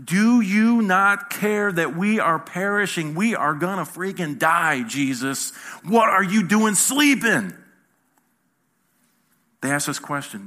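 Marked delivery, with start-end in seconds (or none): 0.0-7.5 loud, drawn-out panicked outcry; freaking out. Acted. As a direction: shake the sleeper awake in acted panic. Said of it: "they're freaking out".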